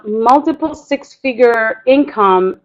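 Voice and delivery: ordinary talk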